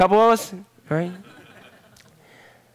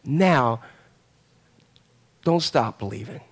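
soft, lower, amused reassurance; gentle God; 5 semitones lower, 5 dB quieter than usual. Retold or imitated. God gently teasing Jonah through his tantrum, soft and low. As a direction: ease him out of his tantrum. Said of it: "And he says".

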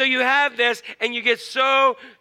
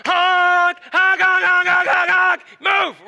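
loud high honks ending in a shouted move; angry honking; 10 semitones higher, 7 dB louder, much slower than usual. Pretend. Frustrated driver blaring at the stalled car, hammed-up angry honking. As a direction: lean on the horn and yell. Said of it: "When you really wanna go".

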